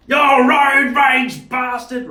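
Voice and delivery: very high, loud, slow shout; drunk old man's shout. Retold or imitated. Drunk old man on the footpath yelling at the driver, drawn-out shout. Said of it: "He's probably seventy".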